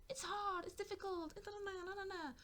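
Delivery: high-pitched, droning complaint; mock whining. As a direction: grumble high, dissolving into na-na. Mimics resistance to being grateful.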